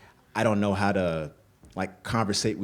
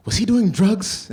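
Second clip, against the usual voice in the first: much higher and louder, slowly asked; worried mom; 13 semitones higher, 8 dB louder, slower than usual. Retold or imitated. Mother at the airport still asking about drugs, high slow worried. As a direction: drag the question out, half alarmed. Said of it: "And she says".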